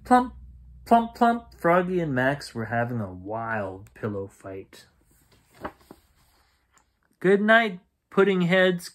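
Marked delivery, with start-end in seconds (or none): 0.0-1.5 high loud thumping sound effect; pillow thumps. A narrator's reading. Boys in a pillow fight, loud thumping plumps. 7.2-8.9 higher louder good night; calling mom. A narrator's reading. Mom calling good night to the boys, louder and higher. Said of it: "cried Froggy's mom".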